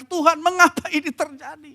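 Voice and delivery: high-pitched, mildly acted cry to God. Preacher voices a believer questioning God, crying out. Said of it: "berseru dan berteriak kepada Tuhan".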